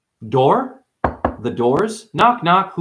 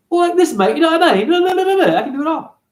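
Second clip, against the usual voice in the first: high, louder mock British accent; 14 semitones higher, 4 dB louder, faster than usual. Shown as a demonstration. Showing off his voices, in a British accent.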